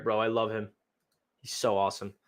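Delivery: ordinary talk, nothing marked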